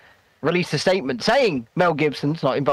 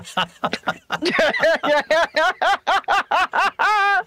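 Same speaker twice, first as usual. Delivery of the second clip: long, high villainous cackle; villain laugh; 11 semitones higher than usual. Acted. Villain laugh before Highlander line.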